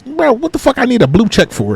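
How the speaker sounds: high-pitched, baffled objection; incredulous protest. Retold or imitated. Mock protest at paying for check.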